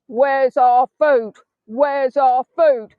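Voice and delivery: loud slow protest chant, mock cat demands; the cats picket outside the house, loud slow mock chant. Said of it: "The cats will be with their placards".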